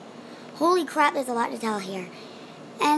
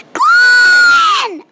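very high, loud drawn-out scream; full scream; 12 dB louder than usual. Acted. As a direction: scream the name as Nancy, long and piercing. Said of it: "and then Nancy screams".

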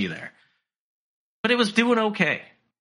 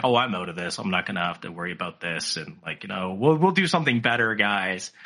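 mock Elon; 7 semitones lower than usual. Elon brushing off the deal to shareholders, low and mumbled. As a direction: mutter it offhand like a tycoon. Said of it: "Elon kinda makes it sound like".

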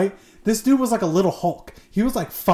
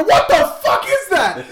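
very high, loud, stretched-out disbelief; acted shock; 12 semitones higher, 5 dB louder, slower than usual. Storyteller replaying what he saw in shorts, shocked. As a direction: replay the horrified reaction, hammed-up.

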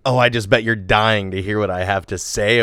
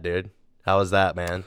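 ordinary talk

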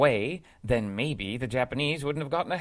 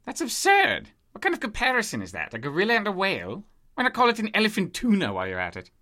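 scoffing disbelief; 6 semitones higher than usual. Acted. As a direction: ridicule the idea in a huff. Scoffing at monster comparison.

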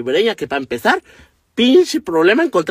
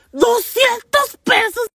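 very high, slow "Doscientos pesos" in shock; shocked at an absurd taxi fare; outraged disbelief; much slower than usual. Real, not acted.

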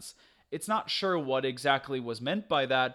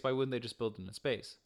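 ordinary talk

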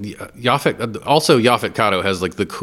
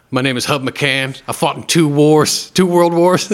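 put-on slow, rising gravelly old-man movie quote; quoting Robert Duvall's proud speech, building louder; 7 semitones higher, 6 dB louder, slower than usual. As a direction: deliver it like a proud old war hero. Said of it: "That line".

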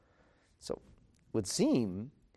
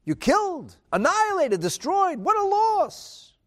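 much higher and louder, a stretched-out lament on loss; mock-angry king; 14 semitones higher, 8 dB louder than usual. King Achashverosh lamenting to Esther, mock outraged. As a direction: ham up a king grieving his losses. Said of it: "And he said".

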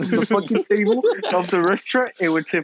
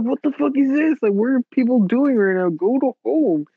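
mock outraged; 7 semitones higher than usual. Mocking the crowd at Cane's.

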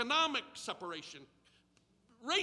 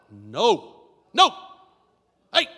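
dog command; 18 dB louder, much slower than usual. Shown as a demonstration. Showing the congregation how to tell the dog no, much louder. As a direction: bark "No" at a misbehaving dog. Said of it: "I'm gonna say it like you're telling the dog".

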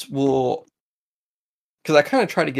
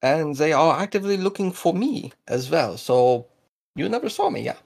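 higher-pitched, insistent Russo-German voice; accented informant; 6 semitones higher, 3 dB louder than usual. Acted. Hunted local swearing the party to secrecy, in Russo-German accent. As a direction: press the warning in a German-tinged accent.

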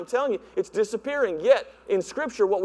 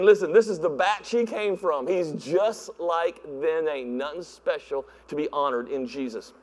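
dismissive scoff; 6 semitones lower than usual. Retold or imitated. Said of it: "they're just saying".

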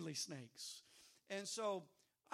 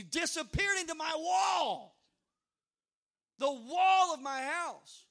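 loud, higher burst of alarm; excited shout; 8 semitones higher, 11 dB louder, slower than usual. Retold or imitated. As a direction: belt it out as the snake vanishes, slowing on the last words. Snake vanishing into his wall.

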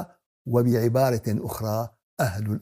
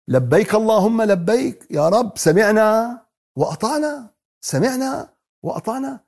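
higher, slower, devout declaration; fervent response; 7 semitones higher, slower than usual. Acted. Preacher voicing the believers' answer to God, high and slow. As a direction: answer God with eager devotion.

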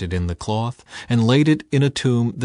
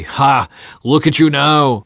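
loud, higher jeering yell; yelling abuse; 4 semitones higher, 7 dB louder than usual. A narrator's reading. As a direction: hurl the insult at him. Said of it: "they yelled at him".

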